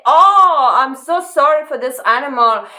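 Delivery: hammed-up sweet sorrow, pitched high; mock-pitying lion. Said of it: "he doesn't say".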